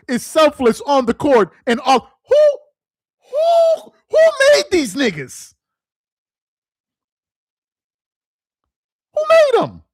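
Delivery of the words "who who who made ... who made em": high, loud outraged yell; who made these, shrieked way up high; incredulous shout. Real, not acted. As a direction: shout it up at the sky in disbelief. Outraged rant at LeBron fans.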